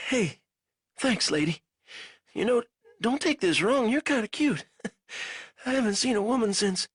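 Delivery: a weak captive fumbling for words; lightly voiced delirious prisoner; feverish prisoner. A narrator's reading. Banks flirts with Ko.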